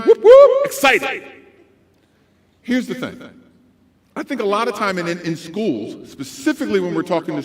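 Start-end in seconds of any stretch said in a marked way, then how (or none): 0.0-1.2 very high, excited whooping; daytime DJ voice. Shown as a demonstration. Speaker contrasts two DJ voices, excited daytime one. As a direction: burst out hyped and over the top.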